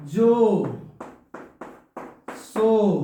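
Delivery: जो and सो called out loud; loud demo words. Shown as a demonstration. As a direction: say जो, सो loudly with a clear open vowel. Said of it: "जैसे". (ज़ोर से जो, सो)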